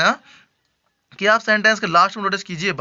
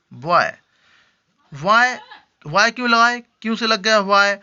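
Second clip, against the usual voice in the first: usual speaking voice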